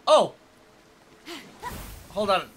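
normal talking voice

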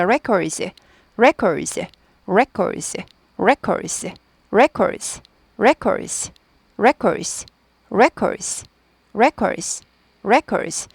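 "records" with a curled r and the d barely heard, said as rekors; swallowed d. Shown as a demonstration. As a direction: repeat "records" with the d nearly lost. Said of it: "드가 발음이 묻힙니다".

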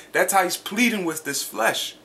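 normal talking voice

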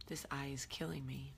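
hushed whisper to herself. Real, not acted. Whispering about her sore eye to herself. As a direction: breathe it out, complaining softly.

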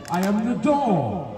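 ordinary talk, nothing marked

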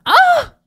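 sudden high shriek; startled scream. Acted. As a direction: shriek as the cage drops. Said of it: "The princess screamed".

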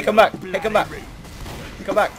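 ordinary delivery

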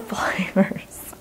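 slow, whispered giggle mid-bite; whispered laugh. Real, not acted. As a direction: laugh softly through the words. Laughing while eating.

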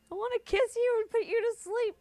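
playful high voice. Real, not acted. Teasing the wild Pokemon.

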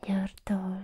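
normal talking voice

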